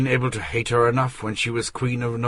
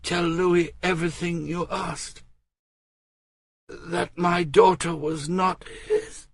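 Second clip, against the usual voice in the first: put-on higher young woman, urgent then slowing; Marguerite pleading; 10 semitones higher than usual. Marguerite tells Robert about her letter, higher pleading. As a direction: plead earnestly with her cousin.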